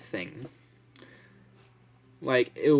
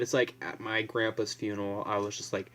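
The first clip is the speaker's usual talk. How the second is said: ordinary talk, nothing marked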